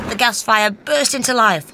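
plain reading voice

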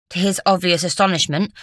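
ordinary delivery

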